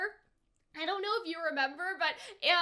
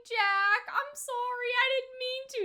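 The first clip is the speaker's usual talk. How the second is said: tearful apology; 7 semitones higher than usual. Retold or imitated. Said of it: "And I'm just like".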